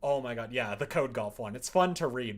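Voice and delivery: normal talking voice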